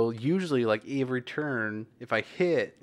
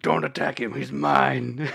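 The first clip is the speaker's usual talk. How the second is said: bad Russian accent, barely there; slower than usual. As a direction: growl it with a light Russian accent. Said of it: "in my Russian accent".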